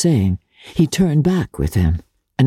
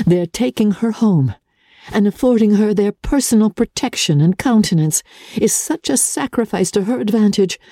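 put-on higher, earnest young woman's voice; a bright young woman thinking it through; voiced young heroine; 6 semitones higher than usual. Elizabeth voiced in story.

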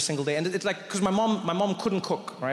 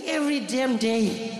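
high, slow, fed-up friend; Thiessen; 6 semitones higher, slower than usual. Retold or imitated. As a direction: drag it out as the exasperated schoolboy. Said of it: "And he's like".

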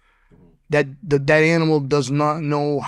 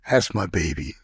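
lower, tender voice for the dog; doting pet talk; 8 semitones lower than usual. Real, not acted. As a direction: coo it low to your pet. Talking fondly to his dog.

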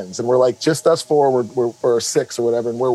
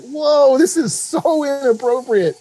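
high, mock-appalled reaction; retold shock; 10 semitones higher, slower than usual. Dad hearing curses near his kids, high retold shock.

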